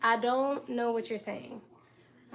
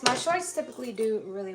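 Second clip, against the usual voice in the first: ordinary talk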